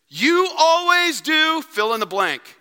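high, loud, slow accusing whine; mock marriage complaint. Spouse accusing the other in an argument, loud and whiny. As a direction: nag your spouse with always. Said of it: "Don't we say this in our marriages?".

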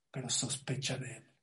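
hushed warning. Acted. Warning after the honour verse.

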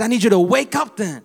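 loud rising preacher's call to wake up; loud wake-up cry; urgent call. Real, not acted. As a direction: shout it like an alarm to sleepers. Preacher urging congregation to wake.

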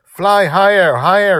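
high, loud, thrilled cry; exhilarated child's yell; joyful shout. A narrator's reading. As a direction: shout it with pure joy from a dragon's back. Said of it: "she shouted with joy".

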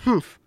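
high, sulky "humph"; frustrated huff. A narrator's reading. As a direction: huff like a bratty child storming off. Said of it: "lets out a loud, frustrated sigh".

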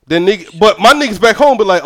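normal talking voice

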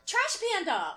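high mock yell; retold screaming. Boyfriend mocking her yelling at a raccoon, mild mock scream. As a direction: imitate someone yelling at an animal.

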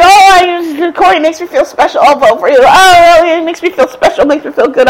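high, mock-gushing enthusiasm; excited young voters. Imitating young folks thrilled by a running mate, mock excited. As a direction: gush like an easily thrilled young fan, hammed up. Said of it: "these young folks who just need to be excited".

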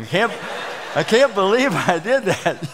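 breathless laughing disbelief; laughing exhilaration. Retold or imitated. Pastor voicing elder after the waterfall, laughing. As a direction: laugh through the words, thrilled.

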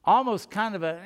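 ordinary delivery

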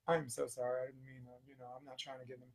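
very soft, trailing apology; retold apology. Man to the boy's mother after cursing at him, softly apologetic. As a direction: back down almost under your breath.

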